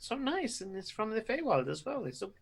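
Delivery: high, soft character voice; delighted plant lover. Acted. Plant lover offers to care for the sweetgrass, high and soft. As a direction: gush softly, pitched well up. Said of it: "so he, he says".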